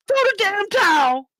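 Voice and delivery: high, loud desperate scream. Retold or imitated. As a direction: scream it from the corner. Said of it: "Duke screaming".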